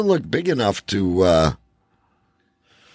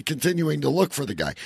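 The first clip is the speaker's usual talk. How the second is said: ordinary talk, nothing marked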